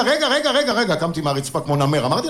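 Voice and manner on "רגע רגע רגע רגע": urgent rising stop-stop; high quick interrupting calls. Retold or imitated. Jumps up excited. (קריאות עצירה נרגשות וגבוהות)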